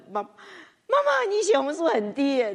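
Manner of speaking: high, loud slowed call of "妈"; calling out to mom. Retold or imitated. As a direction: call to mother across the room, worried. Calling out to her mother. (高声呼喊妈妈)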